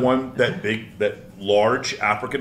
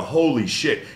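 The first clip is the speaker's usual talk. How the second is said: usual speaking voice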